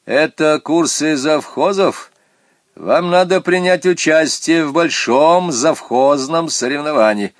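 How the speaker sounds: strict stranger issuing instructions; stern phone voice. A narrator's reading. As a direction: give orders sternly down the telephone. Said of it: "незнакомый голос по телефону строго".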